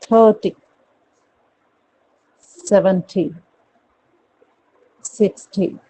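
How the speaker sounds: "Thirty, seventy, sixty" with short falling "टी"; spaced drill, clipped final "टी"; first-syllable stress. Shown as a demonstration. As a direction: hit the start of each number. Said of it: "कैसे बोलोगे?".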